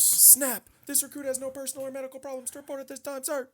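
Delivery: high, fast rattled-off report; recruit sound-off. Retold or imitated. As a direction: bark the report like a rigid recruit. Recruit reporting at nightly inspection.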